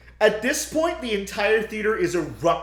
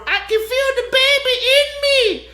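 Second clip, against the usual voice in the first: much higher and louder ecstatic cry; hammed-up high cry; 8 dB louder than usual. Woman rolling on the floor after the ritual, high loud cry. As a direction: squeal it out triumphantly.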